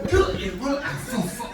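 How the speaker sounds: slow, loud letter by letter; slow spelling. Retold or imitated. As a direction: drag out each letter patiently. Spelling again slowly.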